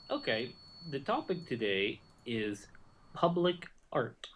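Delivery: slow, hushed announcer voice; dramatic announcement. Acted. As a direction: draw out the reveal. Announcing the topic.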